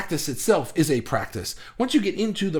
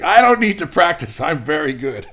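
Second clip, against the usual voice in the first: loud, indignant sitcom bluster; big, blustering comic protest; Ralph Kramden; 8 dB louder than usual. Retold or imitated. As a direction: blurt it out boastfully, much louder at the start. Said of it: "Ralph is like".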